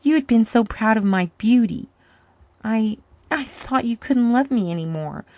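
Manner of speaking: moaning. Acted. Woman confessing to her lover, moaning. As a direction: pour out the shame. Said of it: "she moaned".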